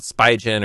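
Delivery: Spigen said as SPY-jen; pronunciation variant. Shown as a demonstration. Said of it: "I don't know if it's called".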